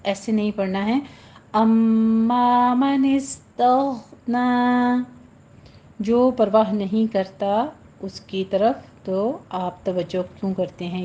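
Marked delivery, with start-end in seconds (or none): 1.6-5.1 slow measured Quranic chant; tajweed recitation. Shown as a demonstration. Teacher models the verse for students, slow recitation. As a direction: draw each vowel out like a reciter.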